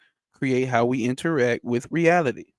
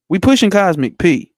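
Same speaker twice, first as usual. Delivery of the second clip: hyped slogan; 5 semitones higher, 8 dB louder than usual. Real, not acted. Hyping the audience's slogan.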